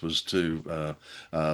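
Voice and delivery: ordinary talk, nothing marked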